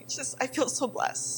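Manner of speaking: usual speaking voice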